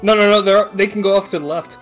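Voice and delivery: ordinary delivery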